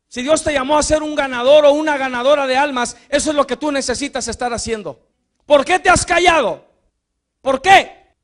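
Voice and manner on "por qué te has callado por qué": higher, louder pointed question; loud challenge. Acted. Preacher demanding of the church, loud and pressing. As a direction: press the listener hard with it.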